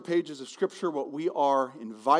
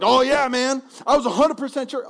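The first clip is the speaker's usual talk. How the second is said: louder, higher cocky boast; sending up someone who swears they were sure; mock overconfident; 8 semitones higher, 8 dB louder than usual. Pastor mocks a churchgoer who claims total certainty, cocky and loud. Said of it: "Anyone who's like".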